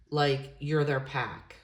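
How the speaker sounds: normal talking voice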